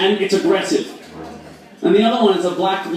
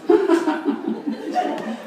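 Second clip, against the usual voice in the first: mock laugh; 4 dB quieter than usual. Mock laughing at giant spider.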